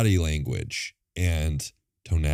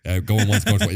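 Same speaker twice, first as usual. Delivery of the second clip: auctioneer; 3 semitones higher, 5 dB louder than usual. Shown as a demonstration. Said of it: "try to talk like a, like an auctioneer".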